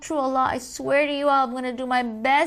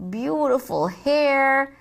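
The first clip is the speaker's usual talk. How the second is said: ordinary talk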